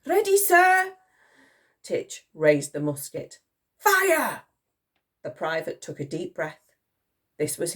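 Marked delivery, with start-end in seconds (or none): 0.1-1.0 high, loud military reply; eager soldier. A narrator's reading. Soldier reporting to the Admiral, loud and high. 3.8-4.4 high barked order; shouted command. A narrator's reading. Admiral ordering the private, shouted command.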